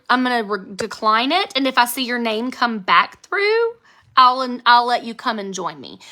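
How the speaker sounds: ordinary delivery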